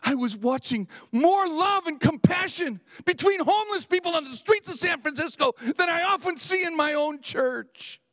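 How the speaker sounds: high, ringing pulpit cry; impassioned preaching. Real, not acted. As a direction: cry it out as a rebuke to your congregation. Preacher rebukes his church.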